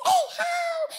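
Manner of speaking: put-on very high child's holler; piping, drawn-out call; excited boy calling. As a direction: sing it out high to the fish. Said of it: "He says".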